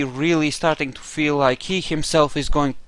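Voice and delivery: ordinary talk, nothing marked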